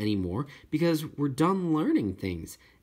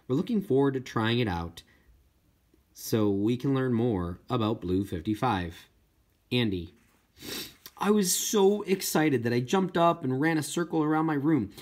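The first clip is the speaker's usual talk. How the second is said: usual speaking voice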